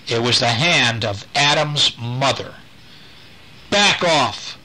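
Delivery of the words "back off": ordering. Acted. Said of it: "Diane ordered".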